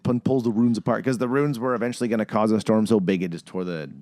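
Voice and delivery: usual speaking voice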